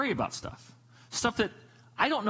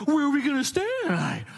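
high-pitched imitation of a panicking kid; mock a child's freak-out, much higher; 9 semitones higher, 3 dB louder than usual. Kid in the car asks about tonight's hotel, imitated freak-out. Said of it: "freaking out".

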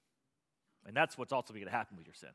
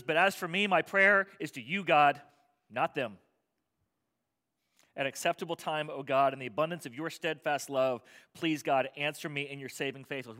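loud, raised pleading reading of scripture; fervent prayer; 6 dB louder than usual. Acted. Psalmist praying for rescue, fervent loud prayer. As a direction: plead it out to God.

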